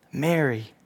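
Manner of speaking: soft, hushed calling of her name; tender name. Acted. Jesus calls her name.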